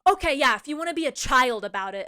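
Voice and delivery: loud, snide retort, imitated; mock yelling. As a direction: imitate a coworker yelling a jab. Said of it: "would yell at me and".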